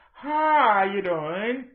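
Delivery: very slow, drawn-out sugary greeting; imitating a slow, syrupy hello; mock sweet greeting. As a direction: stretch it out like fake politeness. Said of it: "That's not how you sound".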